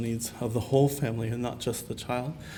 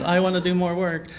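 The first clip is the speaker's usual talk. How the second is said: higher, louder keen-pupil voice; eager little brown-noser; 5 semitones higher, 5 dB louder than usual. Retold or imitated. Recalling self as the keen pupil at school, eager. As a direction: imitate a kid eager to please. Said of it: "the little browner who was like".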